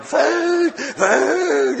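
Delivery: high, loud mock howl for food; famished cry. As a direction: call out desperately, drawn out and high. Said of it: "It's like".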